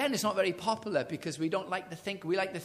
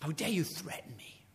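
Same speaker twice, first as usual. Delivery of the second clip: whispered anger; 5 dB quieter than usual. Retold or imitated. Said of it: "He storms out".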